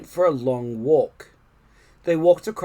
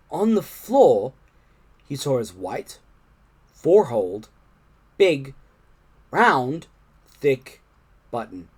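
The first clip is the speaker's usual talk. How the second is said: plain reading voice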